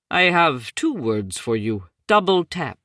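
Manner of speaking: lightly voiced thick Indian accent, deliberate. A narrator's reading. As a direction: tech colleague, thick Indian accent, breaking news. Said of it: "in his thick Indian accent".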